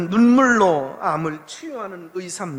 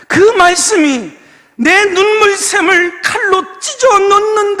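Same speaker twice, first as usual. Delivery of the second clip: impassioned preaching; 9 semitones higher, 8 dB louder than usual. Acted. Pastor telling his flock how he wept, fervent preaching. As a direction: let it swell as the tears come. Recounting the moment he broke down.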